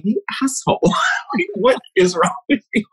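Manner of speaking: high-pitched, laughing mock self-scolding; laughing hysterically. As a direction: retell it through helpless giggles, voice shooting up. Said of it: "I started laughing hysterically".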